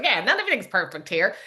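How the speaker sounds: mildly grumpy, dismissive shrug; a touch of grumpy dismissal; grumpy Nigel. Retold or imitated. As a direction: toss it off like a lightly grumpy friend. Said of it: "He had a grumpy thing".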